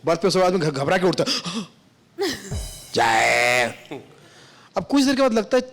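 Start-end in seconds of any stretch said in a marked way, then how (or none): 2.9-3.7 low, rough vendor's cry of "jay"; deep hawker's call in the night; cracked tea-seller call. Retold or imitated. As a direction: bellow it like a hoarse chaiwala on the train. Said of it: "उनकी आवाज इतनी फटी".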